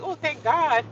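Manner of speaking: high-pitched acted relief; hammed-up thankful outburst; exaggerated relief. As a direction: let the relief leap up high. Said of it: "so, so relieved".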